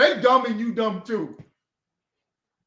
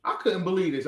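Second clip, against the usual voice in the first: ordinary talk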